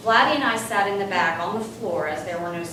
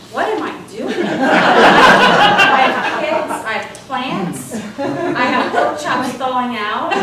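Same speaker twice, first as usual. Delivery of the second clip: high, louder, slow laughing panic; 4 semitones higher, 11 dB louder, slower than usual. Retold or imitated. At the plane door, panicking while laughing. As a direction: laugh through your own mock alarm.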